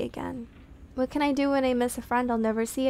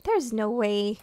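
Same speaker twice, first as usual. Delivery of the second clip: ordinary talk, nothing marked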